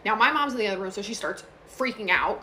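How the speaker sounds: ordinary talk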